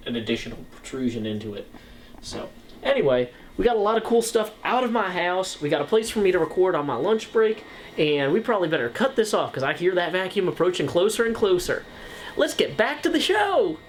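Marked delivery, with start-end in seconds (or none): 3.6-13.8 much higher, big showman call at the end; hammed-up TV host sign-off. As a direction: wrap the segment like a TV host. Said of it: "pretend I was Bob Vila".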